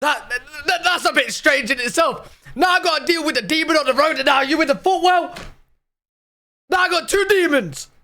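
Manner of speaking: climbing exasperated rant at the story; exasperated disbelief. Real, not acted. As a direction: build up your disbelief line by line.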